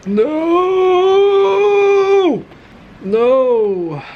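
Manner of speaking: sharp high yelled no; frustrated shout. Real, not acted. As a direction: cry out in frustration. Frustrated at mixture not stiffening.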